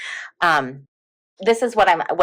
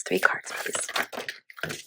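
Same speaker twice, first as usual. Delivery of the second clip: breathy whisper asking politely; whispered request; 13 dB quieter, much slower than usual. Real, not acted. Whispering to the tarot deck for three cards.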